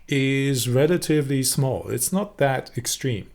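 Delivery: ordinary talk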